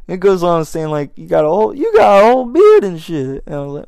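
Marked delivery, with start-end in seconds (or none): 1.2-3.4 high, loud imitated amazement; louder, higher mock disbelief; amazed stranger. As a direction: marvel at the beard like it's unbelievable. Stranger marvels at his beard.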